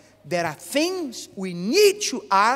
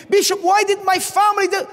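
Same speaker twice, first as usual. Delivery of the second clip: fast, high, loud cry; anguished plea; 11 semitones higher, 6 dB louder, faster than usual. Retold or imitated. Abused woman begging the bishop for why, loud and high. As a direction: hurl the question out in pain.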